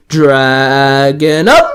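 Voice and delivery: barked. A narrator's reading. Julian calls out his transformation in a barking shout. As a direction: bark it out as he transforms. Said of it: "Julian barked".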